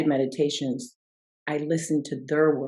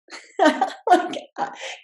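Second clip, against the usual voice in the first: high unvoiced burst of laughter; 4 dB louder than usual. Real, not acted. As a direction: breaks into a loud laugh at her son's words. Laughing at son's suggestion.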